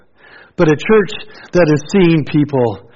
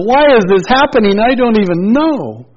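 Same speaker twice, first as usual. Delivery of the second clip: anguished cry; 8 semitones higher, 4 dB louder than usual. Retold or imitated. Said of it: "in that place of".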